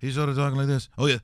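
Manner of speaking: ordinary talk, nothing marked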